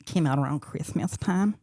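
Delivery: lisping. Retold or imitated. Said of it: "with the lisp from The Cable Guy".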